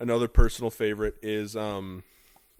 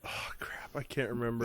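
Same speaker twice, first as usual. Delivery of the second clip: whispered to self; whispered oh crap; 3 semitones lower, 14 dB quieter than usual. Real, not acted. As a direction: breathe it out in frustration. Can't remember the joke.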